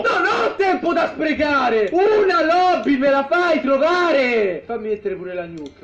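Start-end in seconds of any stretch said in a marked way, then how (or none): 0.0-4.6 loud, slow furious yelling; angry gamer shout. Real, not acted. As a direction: bark it out in rage at the game. Gamer raging at the match.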